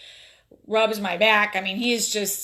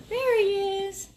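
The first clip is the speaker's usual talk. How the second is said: cooing at the puppy; 11 semitones higher, slower than usual. Real, not acted. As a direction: coo it to the sleeping puppy.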